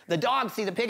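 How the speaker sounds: plain reading voice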